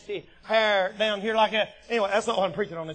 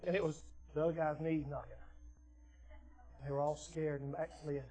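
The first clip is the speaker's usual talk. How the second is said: low, very soft aside; quiet, confiding narration; hushed storytelling; 7 semitones lower, 15 dB quieter than usual. A narrator's reading. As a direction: drop right down after the suspense. Drops low after suspenseful pause.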